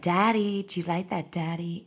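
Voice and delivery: soft, dropping mock sultry daddy; a hint of mock seduction; mock bedroom voice. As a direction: purr it in a fake seductive way. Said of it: "impersonating it".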